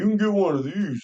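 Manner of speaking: talking through a mouthful; mock mouth-full voice. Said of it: "Talking with his mouth full".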